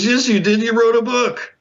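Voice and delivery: very high-pitched retold exclamation; excited insistence. Show boss correcting the guest, high and insistent. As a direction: imitate someone gleefully contradicting.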